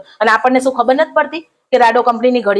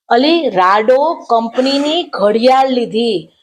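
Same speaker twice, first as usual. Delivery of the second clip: slow, showy drawn-out explanation; slow mimicked friend; 3 semitones higher, much slower than usual. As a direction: spell it out to a dim listener. (ધીમેથી ભાર દઈને સમજાવવું)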